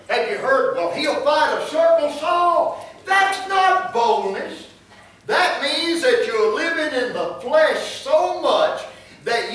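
loud, high-pitched pulpit preaching; full-force preaching. Real, not acted. Preacher warns congregation that this is not boldness, forceful preaching. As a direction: drive each phrase home from the pulpit.

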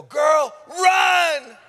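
high, loud shout to flee; shouting to a girl to get away; urgent shout. Retold or imitated. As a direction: yell at her to run now. Yelling to victim to flee.

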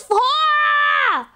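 very high, slow, loud stretched insult; drawn-out high mock cry; mock outrage. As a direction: ham up the fake indignation. Joking outburst at chat.

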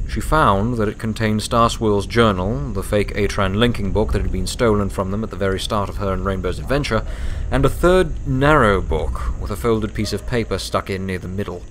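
usual speaking voice

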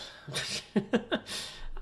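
high chuckle; amused burst of laughter; laughing. Real, not acted. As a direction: laugh at your own rambling. Laughs at own rambling.

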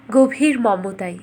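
ordinary delivery, nothing marked

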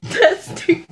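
real giggles breaking through the words, high and soft; can't get the words out for laughing; giggling. Real, not acted. Giggling fit while filming at night.

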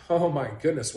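usual speaking voice

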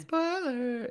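squeaky high Spoiler; high squeal. Pretend. Mock spoiler shout.

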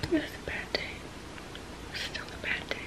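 exhausted whisper; drained whispered recap. Real, not acted. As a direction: barely breathe the words. Whispered recap of bad day.

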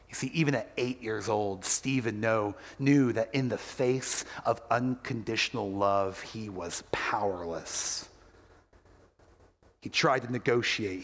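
normal talking voice

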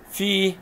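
في held high as a long "fii"; long vowel. Shown as a demonstration. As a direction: draw out the i of في. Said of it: "هنا مد بالياء".

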